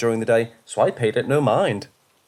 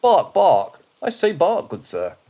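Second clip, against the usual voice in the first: mock a stuffy British gentleman barking; bad British accent; 5 semitones higher, slower than usual. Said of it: "putting on my worst accent".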